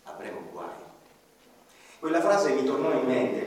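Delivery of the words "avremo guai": low, quiet ominous pronouncement; low dark foreboding; grave verdict. A narrator's reading. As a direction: state it like a final sentence, lower and softer. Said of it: "Anselmo sentenziò".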